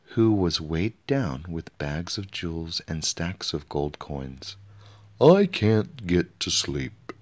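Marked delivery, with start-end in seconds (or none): 5.2-7.1 slow, higher whiny complaint; complaining king. A narrator's reading. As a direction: drag it out like a grumbling little king. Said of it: "complained the king".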